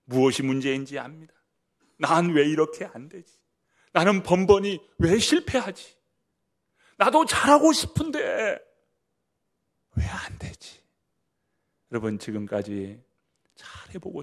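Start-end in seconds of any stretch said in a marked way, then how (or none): 2.0-8.6 loud, high, despairing self-questioning; frustrated cry. Acted. Voicing a struggling believer's lament. 10.0-10.8 the same lament sinking to a whisper; despairing whisper. Acted.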